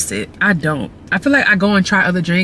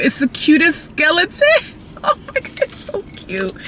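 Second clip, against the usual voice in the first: squealing delight; 10 semitones higher than usual. Real, not acted. Squealing over skeleton cup.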